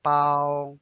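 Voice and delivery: Bao held on a level pitch; flat first tone. Shown as a demonstration. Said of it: "It's flat".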